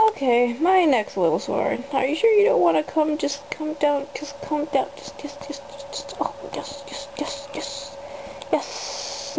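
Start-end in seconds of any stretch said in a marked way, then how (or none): 1.9-4.1 coaxing. Real, not acted. Coaxing rabbit off shoulder. 4.1-9.4 soft whisper to a pet; coaxing whisper. Real, not acted.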